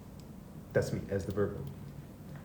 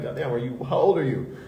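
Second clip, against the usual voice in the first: mock exasperation. Teasing his serious partner, mock exasperated. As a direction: tease her like an old grump. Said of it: "I'm like".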